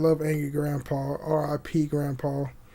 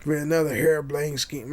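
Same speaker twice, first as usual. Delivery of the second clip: Arthur Morgan. Retold or imitated. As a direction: put on a game cowboy's voice, lightly. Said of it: "you know how his voice is".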